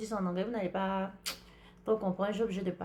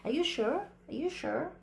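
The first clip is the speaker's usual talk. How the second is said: higher-pitched affected American accent; choco American English; 6 semitones higher than usual. Shown as a demonstration. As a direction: show off a fancy American accent. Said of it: "En choco de anglais". (anglais choco à l'américaine, voix haute)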